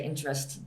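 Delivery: interested cut short to interest; interested without its last syllable; dropped ED ending. Shown as a demonstration. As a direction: leave the TED off interested. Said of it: "You don't say".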